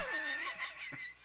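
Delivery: falsetto giggle; high squealing laugh. Real, not acted. Cracking up at the joke.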